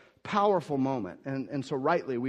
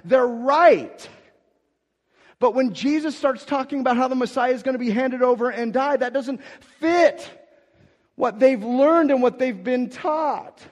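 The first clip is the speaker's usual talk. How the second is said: higher and louder, driving preacher voice; impassioned sermon climax; full-force preaching; 11 semitones higher, 12 dB louder than usual. Acted. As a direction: preach it out to the back row.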